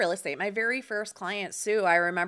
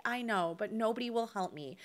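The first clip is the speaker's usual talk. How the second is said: hushed, defeated; 9 dB quieter than usual. Retold or imitated. Veteran retelling being turned down.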